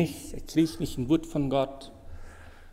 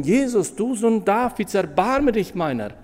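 crying out; 6 semitones higher than usual. Retold or imitated. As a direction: call out to Jesus for mercy. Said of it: "mehr zu schreien".

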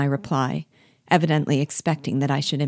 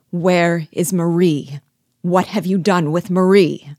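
loud, forceful narrated demand; thundering demand; 3 semitones higher, 7 dB louder than usual. A narrator's reading. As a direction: demand an answer, louder and emphatic. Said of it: "in a voice of thunder I demanded".

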